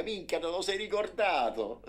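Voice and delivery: high, loud joyful exclaiming; boy rejoicing at his brother; laughing with joy. A narrator's reading. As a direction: burst out happily. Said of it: "ridendo di gioia".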